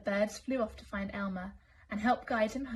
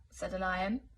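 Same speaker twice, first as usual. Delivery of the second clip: ordinary delivery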